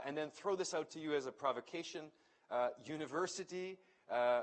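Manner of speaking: ordinary talk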